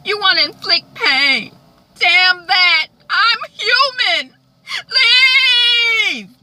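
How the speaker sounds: loud, very high, tearful tirade; building to scream. Retold or imitated. Melissa orders Jeff to leave, building to scream. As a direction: climb from outburst to a drawn-out wailing shout.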